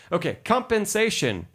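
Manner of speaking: "compensation" said through a laugh. Real, not acted.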